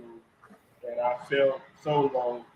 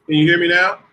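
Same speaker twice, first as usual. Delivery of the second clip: much louder, checking they can hear; raised voice; 3 semitones higher, 11 dB louder than usual. Real, not acted. Guest asked to speak closer to the mic, raised voice.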